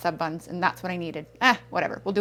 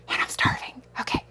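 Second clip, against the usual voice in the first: hushed aside, barely voiced; whispering; 5 dB quieter than usual. Real, not acted. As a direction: drop to a whisper. Aside while unpacking groceries.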